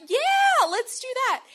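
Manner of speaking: high, loud, eager yeah; eager yes. Retold or imitated. Agreeing to her crush's bad idea, high and eager. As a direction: jump at the idea to please the cute guy.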